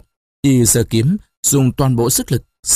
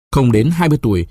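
usual speaking voice

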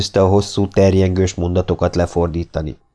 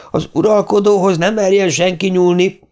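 booming command; 11 semitones higher than usual. A narrator's reading. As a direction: roar the order to protect the ruler. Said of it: "harsogta Pizarro". (Pizarro harsogva, magasan tiltja meg a katonáknak)

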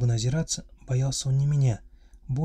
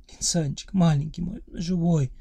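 tearful sobbing; 5 semitones higher than usual. A narrator's reading. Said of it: "крик сменился всхлипами".

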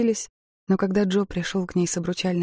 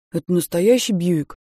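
put-on teenage boy; 6 dB louder than usual. A narrator's reading. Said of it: "спросил подросток".

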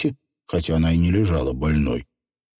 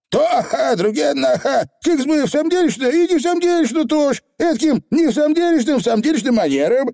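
squeaky pixie, fast and nervous; high tiny rascal squirming; flustered Feegle; 3 dB louder, faster than usual. Acted. Fidgeting Feegle.

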